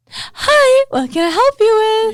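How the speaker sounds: very high, loud service voice; customer service voice. Retold or imitated. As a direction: beam at the customer. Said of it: "I have a lot of, like, that".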